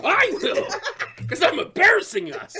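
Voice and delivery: high loud laughing shout; mock yell. Dad yelling back at daughter, laughing and loud. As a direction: yell it like a ridiculous angry dad.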